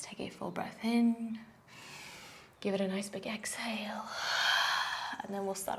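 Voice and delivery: whispered breathing cue; a teacher whispering through the breath. Real, not acted. Breathing cues in down dog.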